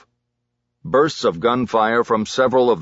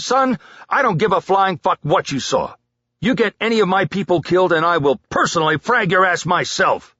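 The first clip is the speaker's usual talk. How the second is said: angry dressing-down; 10 semitones higher than usual. A narrator's reading. Sergeant chews out soldier.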